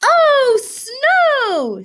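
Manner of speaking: high, loud thrilled cry; excited fox. Acted. Fox thrilled at the first snow, high cry. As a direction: squeal with delight at the snow. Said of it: "said Fox".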